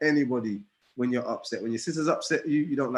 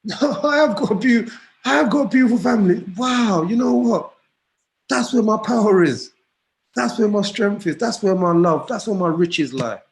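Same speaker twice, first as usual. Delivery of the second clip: high, loud, impassioned declaration; retold proud outburst, full voice; 9 semitones higher, 9 dB louder than usual. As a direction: proclaim it like a man finding his treasure. Retelling his own proud declaration.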